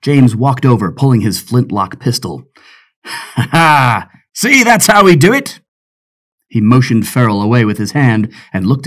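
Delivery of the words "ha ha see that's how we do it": pirate voice jumping high, a bit louder; gloating pirate. Acted. Pirate James gloating as the trunk opens, cocky crowing. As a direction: crow it over your prize.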